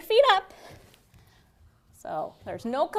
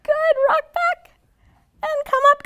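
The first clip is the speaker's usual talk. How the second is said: playful excited; 12 semitones higher, 7 dB louder than usual. Real, not acted. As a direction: sing it out playfully. Playful rocking with kids.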